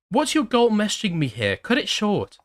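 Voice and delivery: put-on angry ex-girlfriend.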